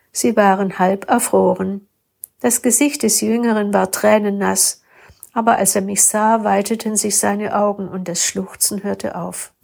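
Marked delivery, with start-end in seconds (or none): none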